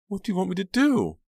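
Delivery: high, loud desperate plea; frightened question. A narrator's reading. Pam asking her attacker, frightened question. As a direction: ask in high-pitched fear. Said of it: "Pam whispered".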